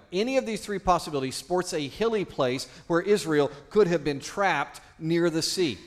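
ordinary talk, nothing marked

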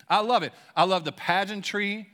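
ordinary delivery

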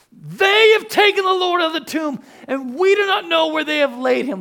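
high, loud, grief-stricken wail; anguished cry. Shown as a demonstration. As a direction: cry it out like a woman whose Lord is gone. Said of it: "I want you to hear how she would say this".

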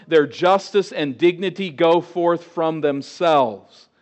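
loud, declaiming scripture reading; preaching scripture. Acted. Preacher reading God's answer to the congregation, loud proclaiming. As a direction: proclaim the oracle with weight. Reads God's oracle aloud.

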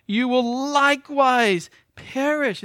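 high, loud and slow, every word weighted; emphatic. Acted. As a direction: lean hard on "You will likewise perish". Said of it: "It's more emphatic".

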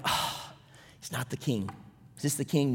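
low, hushed letdown; deflated disappointment. Acted. Reader of Kings realising this king falls short, deflated. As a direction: sigh it out, sinking low and soft.

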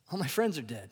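repeats it through a soft laugh; quiet, amused laughing repeat. Real, not acted. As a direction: chuckle through the punchline. Repeats grandma's line, laughing.

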